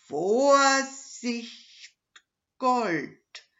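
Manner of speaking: loud, high, thrilled reading of the sign; excited reading. Acted. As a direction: read the label in disbelieving delight. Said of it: "las der Räuber Hotzenplotz".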